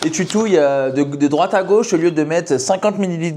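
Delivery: high mock-scolding purist voice; scolding matcha purist. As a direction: imitate a fussy tea snob. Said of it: "je me fais engueuler".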